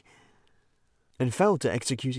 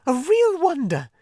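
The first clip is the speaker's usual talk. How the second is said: far higher, louder excited Mole voice; Mole, gushing admiration; 14 semitones higher, 5 dB louder than usual. Acted. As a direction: heap praise on him breathlessly.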